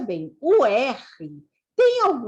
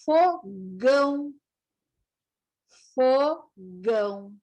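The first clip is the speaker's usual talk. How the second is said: slow, separated syllables; syllable-by-syllable reading; much slower than usual. Shown as a demonstration. Teacher modelling hard G words for beginners, slow syllables. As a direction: sound out each word part by part.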